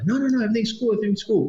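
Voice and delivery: high-pitched, brushing-off imitation; acted breezy reassurance. Retelling the driver answering the worried informant, acted breezy reassurance.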